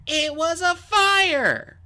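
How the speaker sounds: loud, high, slow emphatic objection; Tsunami interjecting. A narrator's reading. Tsunami interrupts the accusing Skywing, loud interjection. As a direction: cut in forcefully, stretching each word. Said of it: "Tsunami's voice interjected".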